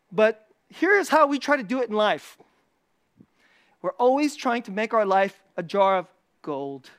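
ordinary talk

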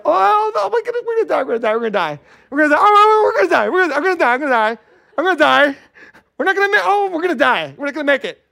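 high, loud hammed-up panic; mock panic. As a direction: wail like you're sure the water will crash down. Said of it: "Some are like this".

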